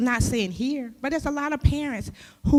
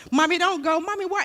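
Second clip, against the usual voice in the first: put-on high, pleading little child voice; 7 semitones higher than usual. Child begging mother at the door, high and pleading. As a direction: beg mommy not to leave, in a high child's voice.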